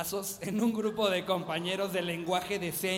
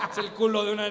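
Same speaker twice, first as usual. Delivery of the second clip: ordinary talk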